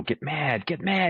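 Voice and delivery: rising high urging; coaching hype. Retold or imitated. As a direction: push him harder with each word.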